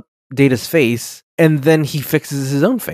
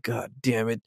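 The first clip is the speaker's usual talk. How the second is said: muttered dad curse; 3 semitones lower, 11 dB quieter than usual. Retold or imitated. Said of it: "gives him the dad look".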